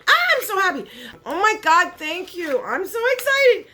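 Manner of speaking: high, loud squeal of joy; giddy excitement. Real, not acted. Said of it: "like a little kid on Christmas".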